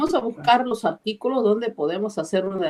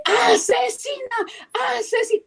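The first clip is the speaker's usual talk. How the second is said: retold shout; 13 semitones higher than usual. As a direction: yell Asesina at her.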